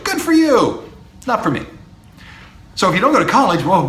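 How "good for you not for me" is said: much higher, a breezy singsong brush-off. Shown as a demonstration. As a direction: toss it off lightly, higher up. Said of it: "There's a phrase".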